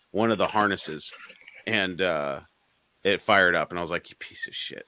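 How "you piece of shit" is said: hushed, voiceless curse acting out anger; retold seething anger. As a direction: curse it under your breath, mock-seething. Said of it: "I was so mad".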